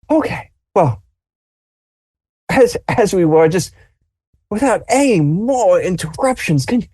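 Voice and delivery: put-on an old man at the end of his patience; exasperated grandfather. Grandfather demanding his tea after the noise dies down, high and exasperated. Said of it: "your grandfather is just like this".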